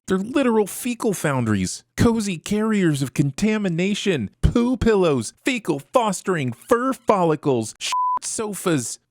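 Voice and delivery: high-pitched, alliterative tirade of mock disgust; disgusted rant. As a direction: rant in revulsion, hitting each item on the list. Mock rant about disgusting seat covers.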